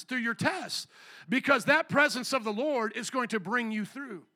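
ordinary talk, nothing marked

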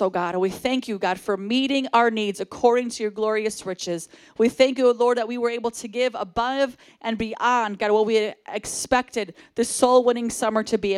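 ordinary delivery

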